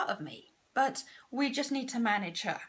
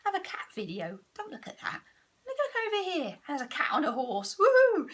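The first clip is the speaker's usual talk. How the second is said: distracting ego; 4 semitones higher than usual. Ego distracting her with cat videos, coaxing to excited. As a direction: lure her away with sudden glee. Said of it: "she'd go".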